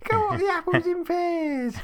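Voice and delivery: high, loud, hammed-up Cockney; mock Cockney accent. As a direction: do a cartoonish Cockney impression. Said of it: "It's the Cockney accent they seem to copy".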